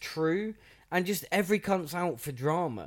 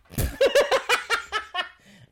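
big laugh; 8 dB louder than usual. Real, not acted. Laughing at own joke.

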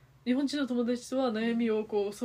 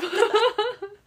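loud laugh; 12 semitones higher, 11 dB louder than usual. Real, not acted. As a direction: burst of high laughter. Friend's surprising story.

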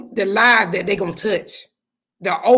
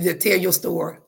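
ordinary talk, nothing marked

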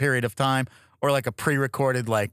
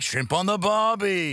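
higher mock Australian catchphrase; Australian accent; 5 semitones higher than usual. Shown as a demonstration. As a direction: put on a stock Aussie accent, pitched up. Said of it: "Can you do an Australian accent?".

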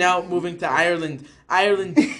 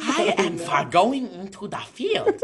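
slow, composed mock German accent; calm German accent; slower than usual. German announcing a trip to the field, calm German accent. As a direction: speak slowly as a calm German. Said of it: "the calm German impression sounds like this".

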